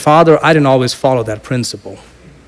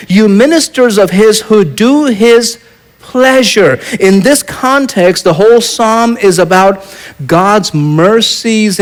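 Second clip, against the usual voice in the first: raised, higher preaching voice with slowed key lines; fervent preaching; 6 semitones higher than usual. Acted. Preacher to his congregation, rising into fervent preaching. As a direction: lift the congregation with the psalm.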